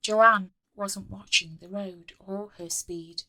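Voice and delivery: ordinary delivery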